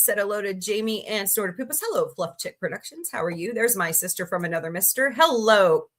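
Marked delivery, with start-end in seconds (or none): none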